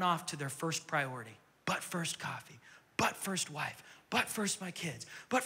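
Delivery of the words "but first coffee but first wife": whispered. Acted. Mocking people's priorities.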